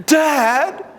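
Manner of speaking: a daughter objecting to dad; indignant child. Retold or imitated. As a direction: whine out the name in outrage. Daughter protests.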